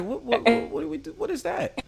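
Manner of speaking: high-pitched, stammering mock confusion; mock bafflement. New Yorker reacting to Baltimore "two", mock baffled. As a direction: act thrown, voice climbing very high. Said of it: "I be like".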